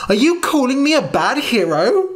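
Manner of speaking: put-on a boy stung by his friend's words; wounded young hero. Norm hurt by the criticism.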